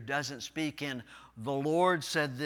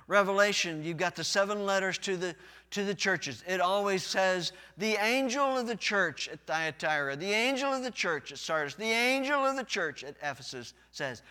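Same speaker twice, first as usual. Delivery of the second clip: louder pulpit delivery; energetic preaching; 5 semitones higher, 5 dB louder than usual. Real, not acted. Pastor preaching the Judges intro with force. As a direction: push it out to the pews.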